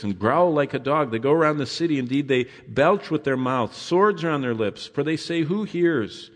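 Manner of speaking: scripture reading. Acted. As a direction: paint the enemies vividly. Preacher reads psalm aloud.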